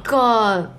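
usual speaking voice

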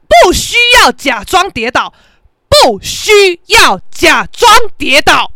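high, shouted challenge, hammering each word; loud confrontational rant. Retold or imitated. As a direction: blast him, slowing down hard on the last demand. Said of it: "我就这么呛". (大声呛人、逐字强调)

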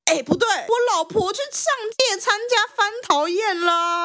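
high-pitched, puzzled protest; sudden realization. Acted. Hero realizing this isn't his wife, startled doubt. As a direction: catch yourself and object, pitched high.

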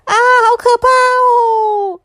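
high, slow, drawn-out scared cry; frightened cry. A narrator's reading. Little Pu, shown a shark's sharp teeth, cries out in fright. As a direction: shriek it out like a frightened little child. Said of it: "害怕的大叫了一声".

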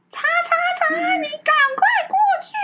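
high, strained shout from another room; imitating a shout. Brother yelling from inside for his son to come over, mock shout. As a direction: call him over at the top of your voice. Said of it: "我哥就在里面喊".

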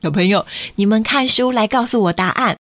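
ordinary delivery, nothing marked

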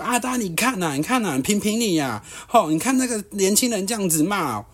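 rally the crowd like a wronged auntie; high mock plea of an old lady seeking sympathy; whiny auntie appeal. Auntie appeals to other passengers.

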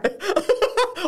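loud high laugh falling away; high burst of laughter. Real, not acted. Laughing at own face.

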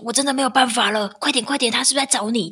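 husband bursting in, frantic for help; frantic plea. Retold or imitated. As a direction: beg for help in a panic. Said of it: "就冲进来说".

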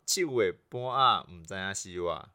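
saying the slang in Taiwanese Hokkien. Shown as a demonstration. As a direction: Taiwanese Hokkien proverb, clearly recited. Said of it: "再一次".

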